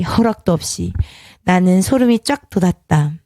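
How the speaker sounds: ordinary delivery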